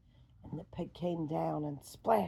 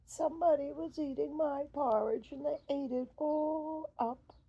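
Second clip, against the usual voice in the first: high, louder little bear voice; Baby Bear; 9 semitones higher, 4 dB louder than usual. Acted. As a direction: squeak it up high and upset.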